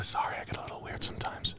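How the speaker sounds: hushed breathy whisper. Retold or imitated. Retold guy apologizing after the pass, in a whisper. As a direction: whisper it like an awkward secret. Creepy guy confessing quietly.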